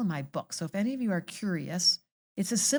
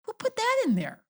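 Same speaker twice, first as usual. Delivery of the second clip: high squeaky mock question; mock incredulous voice; 13 semitones higher than usual. Host asking guest in a mock high voice. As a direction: play the baffled one, pitched way up. Mocking voice of confused humanity.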